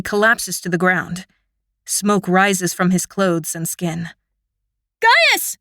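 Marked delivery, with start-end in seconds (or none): 5.0-5.6 very high, loud scream of his name; panicked scream. A narrator's reading. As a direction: scream for your fallen brother. Brother struck down, screaming.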